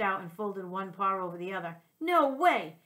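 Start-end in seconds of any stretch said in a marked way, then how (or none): 2.0-2.8 loud, high "No way!" outburst; indignant shout. A narrator's reading. Dog refusing a fancy haircut, loud indignant protest. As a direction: blurt it out in protest.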